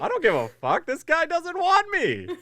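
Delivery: loud, high-pitched mock fury; comic rant. Host threatens to confront the show, comic outrage. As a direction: let the indignation soar absurdly high.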